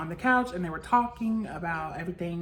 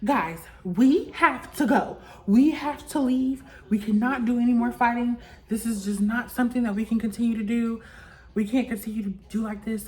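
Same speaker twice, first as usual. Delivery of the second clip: imitating Miss E P; 4 semitones higher, 4 dB louder than usual. Mock producer telling the housemates they must leave, emphatic and bossy. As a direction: lay down the law as a mock show boss. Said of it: "trying to be in her E P bag".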